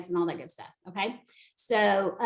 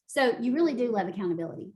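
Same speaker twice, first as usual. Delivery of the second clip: ordinary delivery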